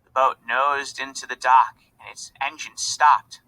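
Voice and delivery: ordinary delivery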